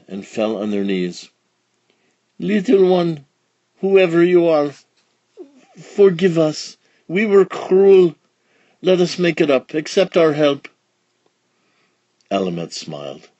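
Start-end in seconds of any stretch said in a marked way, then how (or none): 2.4-10.7 pleading. A narrator's reading. Baker couple begging forgiveness.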